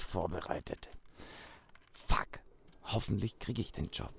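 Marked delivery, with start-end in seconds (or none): none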